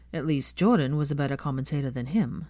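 plain reading voice

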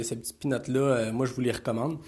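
normal talking voice

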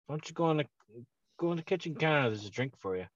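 soft, restrained mock-mom suggestion; mom coaxing. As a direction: imitate a mother nudging politely, voice held down. Mother coaxing, before she explodes.

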